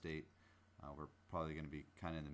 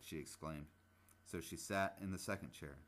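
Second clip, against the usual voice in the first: put-on livelier, louder story narration for children; storybook reading; 4 dB louder than usual. A narrator's reading. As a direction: read the fairy tale with bright, lively energy. Reads Goldilocks aloud.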